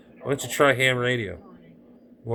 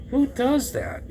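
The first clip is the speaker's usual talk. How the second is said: exasperated; 13 semitones higher, slower than usual. Real, not acted. Exasperated rant about broken kit.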